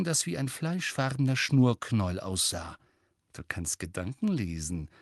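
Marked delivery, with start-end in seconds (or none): 3.3-4.9 soft, sly remark with a grin; grinning. A narrator's reading. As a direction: say it quietly through a grin. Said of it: "sagte Fred grinsend". (leise, grinsend)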